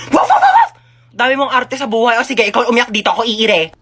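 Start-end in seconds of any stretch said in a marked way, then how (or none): 0.1-0.7 very high, loud yapping; sharp, high-pitched imitation barks; mock dog barking. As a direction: bark sarcastically like a small dog. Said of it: "Gusto mo tumahol ako?".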